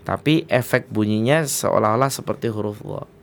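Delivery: usual speaking voice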